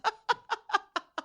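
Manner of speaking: high, quiet, falling laugh; a short, high, quiet laugh. Real, not acted. Host laughing at the guest's story, high and quiet. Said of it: "host laughs".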